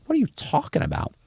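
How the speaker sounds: high, quiet "who, me?" disbelief; retold incredulity. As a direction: act out being baffled by an accusation. Retold baffled reply to accusation.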